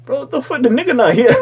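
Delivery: high-pitched, incredulous outburst; acted disbelief. Viewer reacting to a host addressing empty seats, acted disbelief. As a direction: blurt it out, exaggeratedly baffled. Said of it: "And I'm just like".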